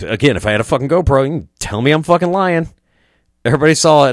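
normal talking voice

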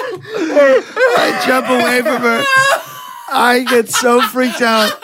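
high, slow words squeezed through laughter; laughing through the story; laughing while telling. Real, not acted. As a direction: barely get it out for laughing.

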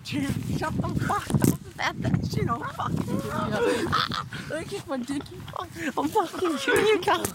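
high yelled kill threats; berserk rage. Acted. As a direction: scream threats in mock fury. Threatening friend in game.

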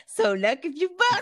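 laughing. Retold or imitated. Quoting song line while laughing.

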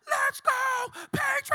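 high, loud stadium roar; drunk fan yell. Retold or imitated. Stranger in the stands yells at the pastor. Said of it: "and he said".

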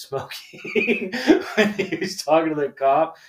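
words breaking into laughter; laughing while telling. Real, not acted. Son telling of his dad's smoking seat, laughing. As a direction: crack up midway through the story.

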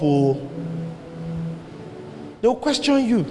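usual speaking voice

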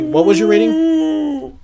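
high, slow, stretched-out whale call. Pretend. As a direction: moan it like a singing whale. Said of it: "that's my whale voice".

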